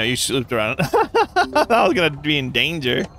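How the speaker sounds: ordinary talk, nothing marked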